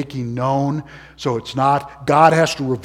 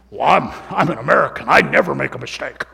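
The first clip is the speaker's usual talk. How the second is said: smug American voice; 2 semitones lower, faster than usual. Pretend. Mocking a smug listener.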